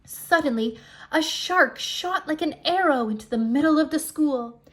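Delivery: loud burst of alarm; sudden alarm. A narrator's reading. Narrator springs the shark attack on listeners, loud and alarmed. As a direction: make the listeners jump.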